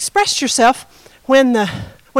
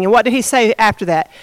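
usual speaking voice

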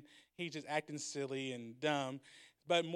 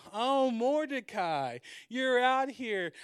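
slow, sneering gloat; taunting villain; 7 semitones higher, 7 dB louder, much slower than usual. Retold or imitated. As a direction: drag it out to mock him. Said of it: "And so he's like".

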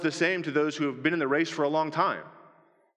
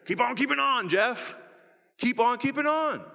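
higher, cheery encouraging call; Jerry Brinker; 9 semitones higher than usual. Retold or imitated. Old church friend Jerry cheering Jeff on, higher and bright. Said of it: "So Jerry always used to say".

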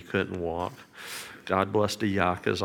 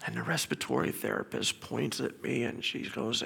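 ordinary delivery

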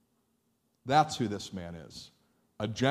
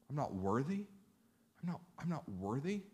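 hushed, with "worthy" lifting at the end; powerfully humble; 2 semitones higher, 9 dB quieter than usual. Acted. Centurion tells Jesus he is unworthy, humble hush. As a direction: say it very softly, in humble wonder. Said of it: "That's powerfully humble".

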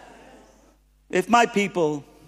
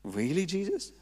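quiet disbelief; 9 dB quieter than usual. Retold or imitated. Incredulous aside to God.

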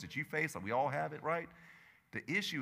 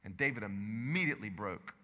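normal talking voice

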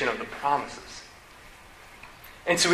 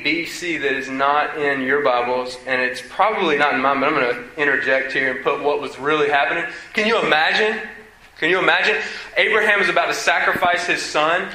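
ordinary talk

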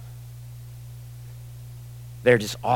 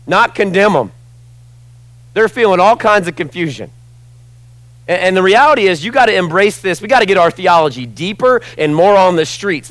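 higher and louder pulpit preaching; fervent sermon appeal, higher and louder; full-force preaching; 7 semitones higher, 8 dB louder than usual. Real, not acted. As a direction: press the point hard to the congregation.

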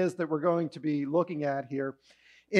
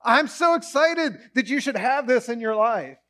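much higher, excited yes; emphatic joy; 7 semitones higher, 4 dB louder, slower than usual. Retold or imitated. God eagerly saying yes to a prayer. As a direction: burst with delight. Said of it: "God is emphatically saying".

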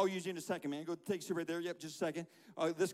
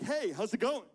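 loud, casual hello; distracted greeting; 7 dB louder than usual. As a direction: call out a chatty hello mid-worship. Acts distracted worshipper greeting someone.

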